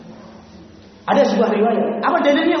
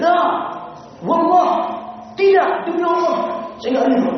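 shouted refusal. Retold or imitated. Young man shouts he would never accept his mother dishonoured. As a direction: refuse with all your force, swearing by God. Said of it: "Dengan teriak".

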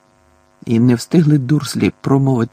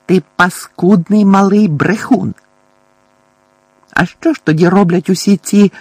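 loud, raised-pitch accusation; angry scolding; 6 semitones higher, 6 dB louder than usual. A narrator's reading. Aunt calls her nephew a liar, angrily. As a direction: spit out the accusation, high and forceful.

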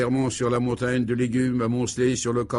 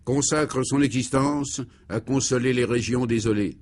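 sermon; 4 dB louder than usual. Acted. Priest preaching his famous sermon. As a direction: proclaim it from the pulpit.

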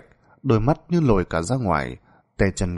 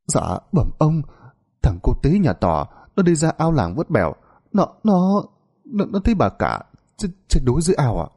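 high, breathless stammer; panicked stammering; 6 semitones higher than usual. A narrator's reading. Servant boy reporting the drowning to the master, frightened stammer. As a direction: stutter out the news in fright.

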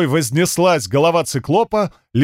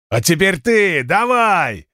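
challenging cyclops; 4 semitones higher, slower than usual. Acted.

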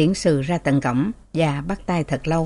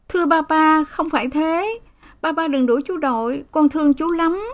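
put-on high little girl's voice, tearful plea; pleading child; 9 semitones higher than usual. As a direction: beg papa like a small girl. Child Rosalind pleads with father. (giọng bé gái van nài)

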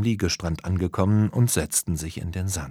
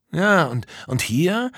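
higher, questioning character voice; puzzling; 9 semitones higher, slower than usual. Acted. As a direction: wonder aloud, ending on a raised question. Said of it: "rätselte Tom weiter".

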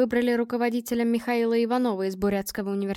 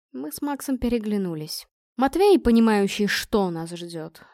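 usual speaking voice

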